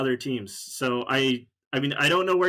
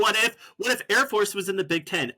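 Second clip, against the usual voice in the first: mocking quote; 6 semitones higher than usual. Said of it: "You're saying".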